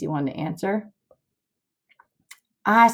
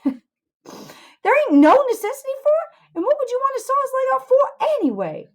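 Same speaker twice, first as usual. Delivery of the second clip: incredulous protest; 5 dB louder than usual. Acted. Huck objects to sawing Jim's leg off, incredulous. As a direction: squeak it out, aghast at the idea.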